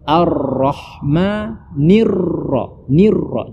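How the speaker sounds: "Ar-Rahmanir Ra" slowly, rolling into the R; drawn-out recital landing on a rolled "Ra"; clear ra. Shown as a demonstration. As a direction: stretch the trilled R in "Nir Ra". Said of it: "tetapi huruf ra".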